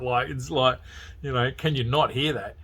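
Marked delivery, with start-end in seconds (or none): none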